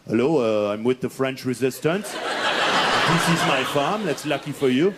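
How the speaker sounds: low-pitched, badly faked French accent; hammy low French accent; terrible French accent. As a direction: play a French resistance farmer, deep voice. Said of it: "that accent's really terrible".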